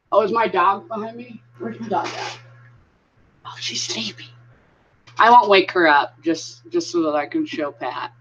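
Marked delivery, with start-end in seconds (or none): none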